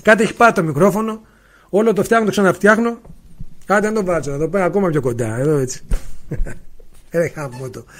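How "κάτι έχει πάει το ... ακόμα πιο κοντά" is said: ordinary talk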